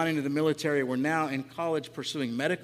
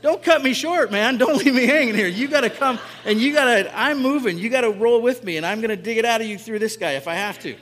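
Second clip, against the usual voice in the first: higher, louder, urgent pleading voice; the Spirit urging him, higher and louder; 7 semitones higher, 10 dB louder than usual. Retold or imitated. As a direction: push hard like a spirit that won't let go. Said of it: "The Spirit saying".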